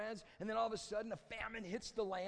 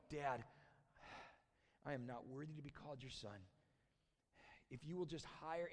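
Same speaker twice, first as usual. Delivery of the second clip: low, subdued son's confession; rehearsed apology; 4 semitones lower, 6 dB quieter than usual. Acted. Prodigal son starts his spiel to his dad, humble apology. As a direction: recite a humble apology, lower and softer, with halting pauses. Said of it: "he starts his spiel".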